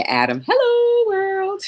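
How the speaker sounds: high, drawn-out sing-song hello; excited greeting. Real, not acted. Livestreamer greeting a viewer who just joined, excited.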